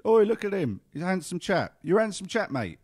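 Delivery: loud, high shout of a passer-by; passing stranger. Retold or imitated. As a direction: call out admiringly like a bloke in the street. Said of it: "A man, a man did walk past me yesterday and said".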